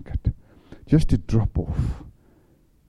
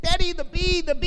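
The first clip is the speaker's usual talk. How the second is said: put-on high, pleading child's voice, much higher; a scared small boy crying out to daddy; frightened little boy. Frightened child calls to dad.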